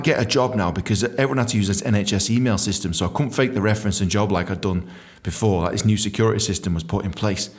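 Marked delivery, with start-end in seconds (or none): none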